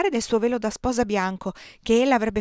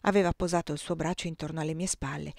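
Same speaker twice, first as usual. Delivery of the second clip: usual speaking voice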